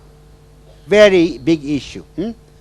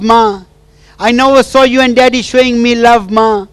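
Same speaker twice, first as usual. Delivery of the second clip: high, loud, pleading and tearful; tearful plea to mother; 6 semitones higher, 6 dB louder than usual. Retold or imitated. Wife pours out her hurt to her absent mother, tearful and loud. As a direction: cry out to the mother who left her. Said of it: "And she's crying".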